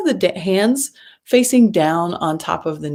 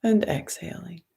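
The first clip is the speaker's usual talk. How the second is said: breathy whispered breath cue; 7 dB quieter than usual. Acted.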